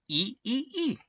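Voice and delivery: very high tiny squeaks; mouse squeak. A narrator's reading. Field mouse agrees to help, squeaking high.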